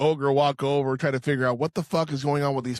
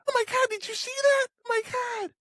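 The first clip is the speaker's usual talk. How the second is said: much higher, fast, breathless amazed voice; excited high impression; faster than usual. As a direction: squeal it like someone stunned by what they just saw. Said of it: "You do that very well".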